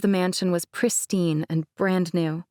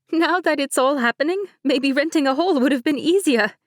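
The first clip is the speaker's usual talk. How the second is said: light higher-pitched bride, laughing it off; Mercedes voice; 8 semitones higher, 5 dB louder than usual. Acted. Bride Mercedes teasing her cousin, higher light voice. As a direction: play the bride breezily.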